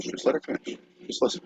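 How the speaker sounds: hushed whisper. Pretend. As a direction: whisper it urgently. Hushing interrupting grandma.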